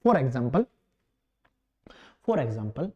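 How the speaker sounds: low, measured dictation with long gaps; softly spaced-out classroom dictation; slow dictation. Real, not acted. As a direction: dictate word by word so students can write. Dictates answer for students to write.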